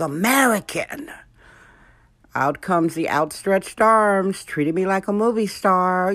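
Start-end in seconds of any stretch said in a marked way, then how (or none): 0.0-1.1 put-on American accent. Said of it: "And then I'd open my mouth".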